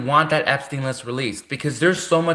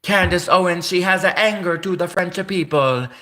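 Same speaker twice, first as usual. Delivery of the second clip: hammed-up French accent; a hint of a French accent; mock French accent; 3 semitones higher than usual. Shown as a demonstration. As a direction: put on a French accent for the line. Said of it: "I can't do French".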